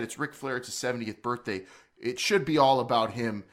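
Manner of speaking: usual speaking voice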